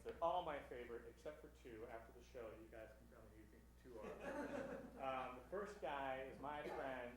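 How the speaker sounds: usual speaking voice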